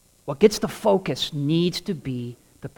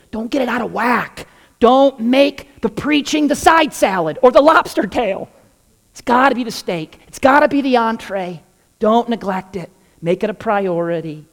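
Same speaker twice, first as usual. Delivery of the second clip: preaching; 6 semitones higher, 4 dB louder than usual. Real, not acted. Preacher's climax exhortation.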